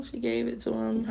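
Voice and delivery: usual speaking voice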